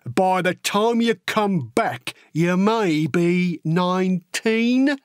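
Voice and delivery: slow, drawn-out, knowing hint; sly old sergeant. Acted. Sergeant hinting the boy should lie, slow and pointed. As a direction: spell it out slowly for a lad.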